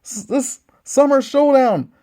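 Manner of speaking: fast, punchy ringside commentary; excited wrestling announcer calling the match; wrestling play-by-play. Acted. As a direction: call every move like a live TV commentator.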